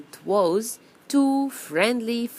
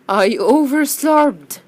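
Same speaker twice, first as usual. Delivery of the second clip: high, loud, drawn-out confession; exclaiming; 6 semitones higher, 11 dB louder than usual. Acted. Bee explains itself to the other bees, exclaiming. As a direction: blurt it out loudly.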